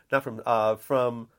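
usual speaking voice

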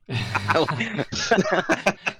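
loud laugh at someone wandering off; a big real laugh; loud burst of laughter. Real, not acted.

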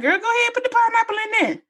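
put-on high-pitched girlfriend urging; egging on a friend. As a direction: urge her on in a high voice. Acts out egging on her friend.

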